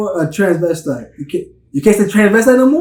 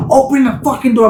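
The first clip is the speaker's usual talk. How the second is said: higher, demanding mock cop yell; angry cop; 7 semitones higher, 3 dB louder than usual. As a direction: shout it at him like an aggressive officer. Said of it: "He's bang, he's like".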